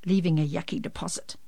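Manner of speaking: plain reading voice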